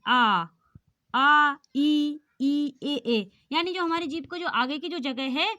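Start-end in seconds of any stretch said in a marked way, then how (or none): 0.1-2.9 आ, ई, ए said loud and drawn out, one by one; careful loud vowel drill; vowel demo. Shown as a demonstration. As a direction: sound out each front vowel. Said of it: "अब बोल के देखिए".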